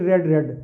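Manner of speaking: "रेड" said like red, not reed; pronunciation drill. Shown as a demonstration. Teacher drilling students on "रेड" as past form. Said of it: "third form है रीड".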